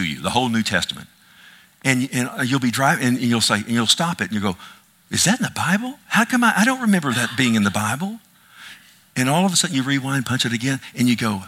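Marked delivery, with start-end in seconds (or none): 5.1-8.2 higher-pitched, incredulous questioning; puzzled listener. Acted. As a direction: act out someone caught off guard and doubting.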